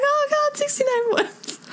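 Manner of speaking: tiny, very high-pitched squeal of delight; starstruck squeal. Retold or imitated. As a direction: squeal it like a thrilled fangirl. Acts out own thrilled reaction.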